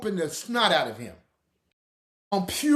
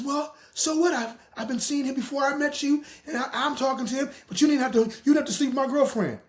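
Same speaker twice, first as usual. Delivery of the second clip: mock girlfriend voice; 7 semitones higher than usual. Voicing the girlfriend.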